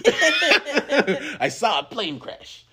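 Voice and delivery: loud, very high, drawn-out hammed-up voice; Natasha Lyonne's Russian Doll character. Actress as her series character in the movie, loud laughing impression. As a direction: blow the impression up big, slow and laughing. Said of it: "her Russian doll character".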